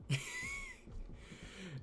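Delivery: high burst of laughter. Real, not acted. Gamer laughing at his own boast. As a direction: laugh at your own exaggeration.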